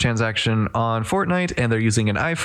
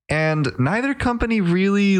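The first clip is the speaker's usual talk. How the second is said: ordinary talk, nothing marked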